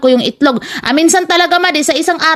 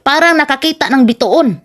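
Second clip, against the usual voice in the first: ordinary delivery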